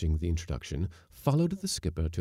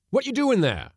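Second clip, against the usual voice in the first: high, loud demand; shouted challenge; 9 dB louder than usual. Acted. Skipper catching Bill in the galley, shouting. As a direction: call him out sharply.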